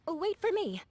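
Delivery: high-pitched hurried call; calling after friends. Acted. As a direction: call out so they wait. Calling after friends leaving for contest.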